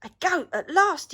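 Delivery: loud, high happy cry; excited cry. A narrator's reading. Troll spotting goat hoof prints, excited cry. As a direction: cry out with glee. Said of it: "he cried".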